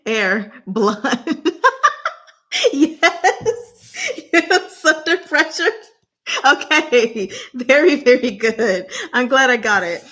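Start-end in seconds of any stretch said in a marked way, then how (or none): 1.5-4.2 laughing. Real, not acted. Laughs after getting it right. 4.3-10.0 excited. Real, not acted. Celebrates a correct guess.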